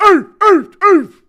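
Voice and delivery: loud, high-pitched effort grunts. Pretend. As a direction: grunt hard with each hit. Fighter grunts while hitting Chandler.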